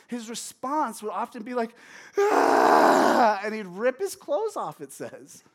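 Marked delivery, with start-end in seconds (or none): 2.2-3.4 loud, drawn-out anguished scream; hammed-up wail of grief at full volume; acted scream. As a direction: scream out like David in distress. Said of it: "he would just scream and cry".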